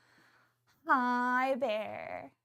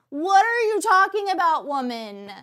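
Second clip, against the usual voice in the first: loud, higher indignant outburst; mock outrage; 6 semitones higher, 10 dB louder than usual. Chef reacting to friend's menu choice, mock outrage. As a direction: exclaim it in retold disbelief.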